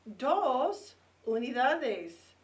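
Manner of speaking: decenas stretched slowly with rising ends; slow teaching stress. Shown as a demonstration. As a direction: lay out decenas slowly for the class. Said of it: "counting breakdown".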